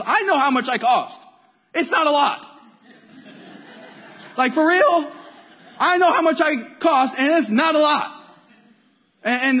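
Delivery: loud, high, exasperated venting; angry, frustrated rant. Retold or imitated. Youth minister venting about pay with a baby on the way, angry rant. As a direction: re-enact an aggrieved rant at full volume. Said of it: "So I'm getting angry".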